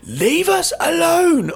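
drawn-out, high and loud mock scolding; teacher's telling-off. Girl repeats it to Michael on the stairs, slow loud teacher-like scolding. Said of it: "Almost like a teacher".

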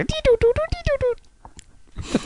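toy robot voice. Pretend. Robot beeping noises.

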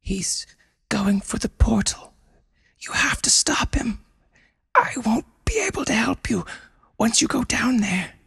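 high breathy whisper; urgent high whisper. Acted. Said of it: "Mei Lon whispered".

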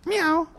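high mock meow; squeaky playful meow; cat meow. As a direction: meow like a kitten to tease the kids. Playing cat for kids.

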